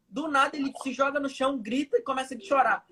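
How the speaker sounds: ordinary delivery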